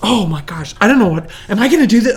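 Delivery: loud, rushed mock dithering; retold fluster. She doubts marrying for the third time, retold fluster. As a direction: act out your own panic, half laughing. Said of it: "I was a mess".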